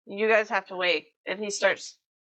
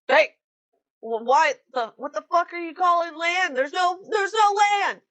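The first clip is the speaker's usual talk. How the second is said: raised, indignant captain; angry captain; 7 semitones higher, 4 dB louder than usual. Acted. Captain grabbing a sailor, raised and angry. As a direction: demand answers from the sailor he grabbed. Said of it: "grabs the guy next to you".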